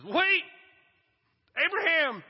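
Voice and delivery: high, loud urgent stop; God halting him. Acted. God stopping Abraham's knife, loud urgent call. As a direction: cut him off sharply. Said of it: "And God says".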